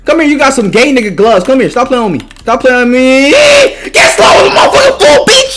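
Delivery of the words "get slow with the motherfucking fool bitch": high yelled taunt at the opponent; taunting shout. Real, not acted. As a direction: scream it at him mid-fight.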